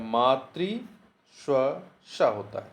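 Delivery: मातृश्वसा stretched out very slowly; slow, deliberate repeat. Shown as a demonstration. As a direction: drag मातृश्वसा out so it sinks in. (मातृश्वसा बहुत धीरे खींचकर)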